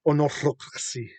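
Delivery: put-on "Unorthocracy" in an Elvish accent, falling. Host saying the name in an Elvish accent, falling. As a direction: say "Unorthocracy" like an elf. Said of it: "like, an Elvish accent".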